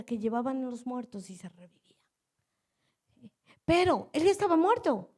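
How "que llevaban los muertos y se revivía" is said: hushed, breathy-free whisper, a bit fast; awed sermon whisper, quick. Acted. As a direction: lean in and whisper the miracle. Preacher drops to whisper for awe.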